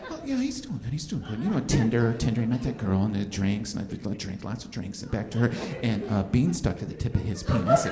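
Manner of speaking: husband whispering a cover story to his wife at two a.m. Retold or imitated. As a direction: whisper the excuse as if someone might overhear. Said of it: "I'm whispering for some reason".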